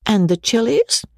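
high question on the verge of laughter; gleeful teasing. A narrator's reading. Joe recalls the guest eating chilies, tickled. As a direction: ask it with pitch leaping, about to giggle.

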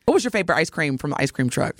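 ordinary talk

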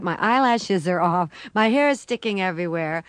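usual speaking voice

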